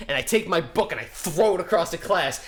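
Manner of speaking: ordinary delivery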